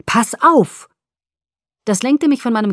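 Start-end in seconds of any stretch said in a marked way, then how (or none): 0.1-0.9 loud, higher urgent warning; angry warning shout. A narrator's reading. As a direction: snap out the warning. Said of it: "rief mir die honigsüße Stimme wütend".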